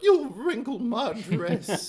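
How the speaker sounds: high, slow prissy Glenda through a laugh; a fussy lady's voice, laughing into it. Acted. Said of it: "I'll probably veer into Glenda too".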